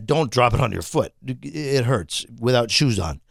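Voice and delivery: usual speaking voice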